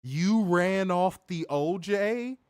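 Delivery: slow, high, loud disbelief; incredulous. Real, not acted. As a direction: stretch it out in shock. Incredulous repeat of quote.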